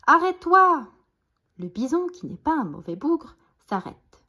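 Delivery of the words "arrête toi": high, loud plea; desperate shout. A narrator's reading. Billy begs the galloping bison, desperate shout. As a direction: cry out at the end of your strength. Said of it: "crie Billy à bout de force".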